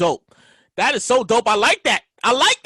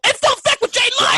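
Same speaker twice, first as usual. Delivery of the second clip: hype; 10 semitones higher than usual. Real, not acted. Hyping the show's name.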